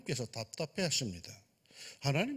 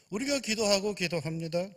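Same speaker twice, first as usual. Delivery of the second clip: ordinary talk, nothing marked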